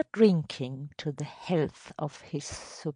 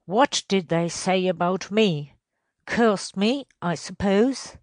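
louder, pressing superintendent; demanding question; 4 semitones higher, 6 dB louder than usual. Acted. Superintendent asks the elder what was said about him, demanding. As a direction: press for an answer, voice rising.

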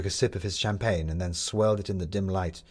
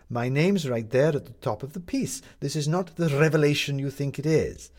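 higher-pitched, emphatic comeback; defensive, raised-pitch retort; Maddie's rebuttal; 7 semitones higher, 3 dB louder than usual. A narrator's reading. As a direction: brush off the accusation pointedly. Maddie rebuts his accusation.

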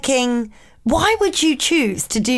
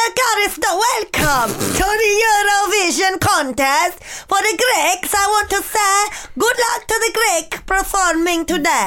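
put-on higher, Borat-like Greek accent; bad Greek accent; 6 semitones higher than usual. Cohost plays Greek Eurovision presenter, bad Greek accent sounding like Borat. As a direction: announce it in a heavy, mistaken Greek accent. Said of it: "Do Greek".

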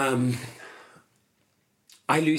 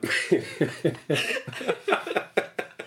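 high breathy laugh; 6 semitones higher than usual. Real, not acted.

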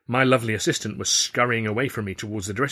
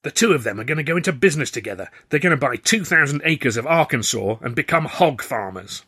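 fast, louder excited gossip; 5 semitones higher, 6 dB louder than usual. Acted. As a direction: rush it out, thrilled to tell.

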